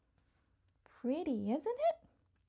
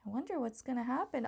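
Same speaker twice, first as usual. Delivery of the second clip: plain reading voice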